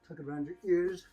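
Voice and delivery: ordinary delivery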